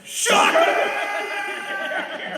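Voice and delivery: high, loud hammed-up villain; villain shout. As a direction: bark it like a movie villain. S0 voicing the villain.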